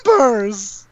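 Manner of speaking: high, stretched holler; shouted character voice. Acted.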